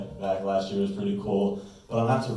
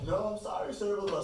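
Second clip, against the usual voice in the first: high, much softer mock customer service; bank lady; 10 semitones higher, 9 dB quieter than usual. Bank lady refusing the card, high and soft. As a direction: rattle off a dismissive phone apology. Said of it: "she's like".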